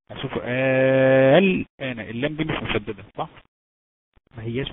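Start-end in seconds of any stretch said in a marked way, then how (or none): 0.4-2.0 الآن with the first «آ» held very long and loud; madd elongation. Shown as a demonstration. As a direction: chant الآن, sustaining the opening vowel. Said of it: "طيب مثال ايه؟". (مدّ طويل مرتّل في أول الآن)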